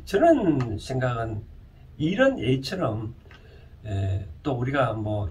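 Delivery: ordinary talk, nothing marked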